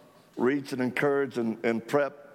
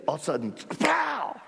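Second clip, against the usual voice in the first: a sudden higher cry on pow; gunshot pow; 9 semitones higher than usual. Retold or imitated. Telling friends about the gunfire, pow said as a sharp cry. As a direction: punch out the gunshot sound, high.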